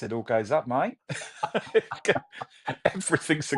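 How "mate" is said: mate lifted up like a question; Aussie rising tune. Shown as a demonstration. Showing how Aussies end everything as a question with a rising tune. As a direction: end mate on a high questioning rise in Australian style. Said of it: "everything in Australia is a question at the end".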